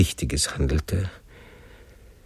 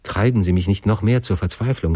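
usual speaking voice

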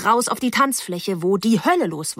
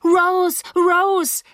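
high, urgent call of a name; frantic calling; 11 semitones higher, 4 dB louder than usual. Acted. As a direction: call out for help, high and desperate. Frantic girl calls for help.

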